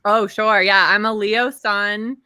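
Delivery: ordinary delivery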